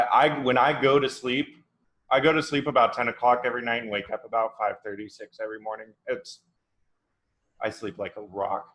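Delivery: ordinary talk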